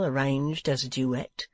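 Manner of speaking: ordinary delivery, nothing marked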